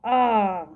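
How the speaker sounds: "А" held long and clear; sustained, emphatic "А"; isolated vowel. Shown as a demonstration. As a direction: draw out a pure "А". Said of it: "Останній звук".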